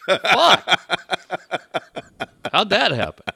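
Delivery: high, loud, laughing; mock surprise. The car guy stunned it sold, laughing mock surprise. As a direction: act stunned at the sale.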